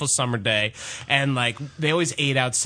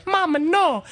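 Trina's Mama no; 4 dB louder than usual. Retold or imitated.

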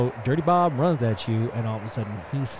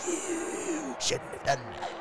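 very high, slow, thin croak; scorched fighter's threat; 7 dB quieter, slower than usual. Acted. Smoldering fighter crawling up to threaten his attacker, strained high voice. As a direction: squeeze out the threat slowly, high and soft. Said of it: "he gets up and he's like".

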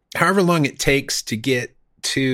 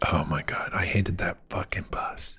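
whispering; 4 semitones lower, 8 dB quieter than usual. Real, not acted. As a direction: hiss it out under your breath. Recalling the bad bus.

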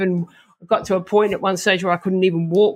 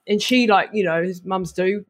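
ordinary talk

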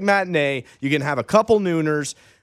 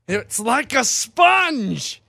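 high, very slow and drawn out; slow drawn-out quote; 9 semitones higher, much slower than usual. Retold or imitated. Equipment guy describing Brady's ball, slow and high. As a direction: stretch each word out like you can't believe it. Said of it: "and he goes".

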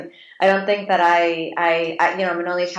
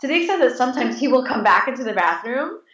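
ordinary talk

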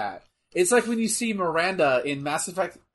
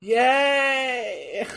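higher, stretched-out little cheer; drawn-out cheer; 6 semitones higher than usual. Real, not acted. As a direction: cheer it in one long word. Cheering while watching the match.

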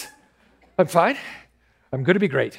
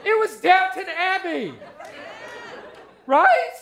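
high, giddy fan gushing, rising to a shout; starstruck squeal; 13 semitones higher than usual. Acted.

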